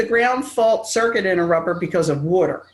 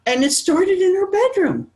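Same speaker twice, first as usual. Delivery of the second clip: mom's dramatic line; 10 semitones higher than usual. Retold or imitated. Mom voiced in retold story.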